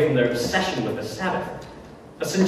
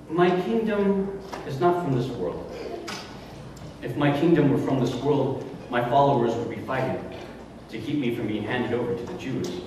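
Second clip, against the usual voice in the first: quoted prisoner; 4 semitones lower, 6 dB quieter than usual. Retold or imitated. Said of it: "He said".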